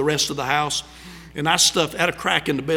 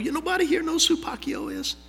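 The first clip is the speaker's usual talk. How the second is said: high, incredulous question to the crowd; can't believe nobody knows him; surprised disbelief; 12 semitones higher, 5 dB quieter than usual. Real, not acted. Preacher to congregation who don't know Pacquiao, amazed.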